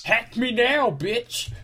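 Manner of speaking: high-pitched, cocky mock dare; mock taunt. Punch-card owner daring hackers, mock taunting. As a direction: taunt them in a jokey high voice.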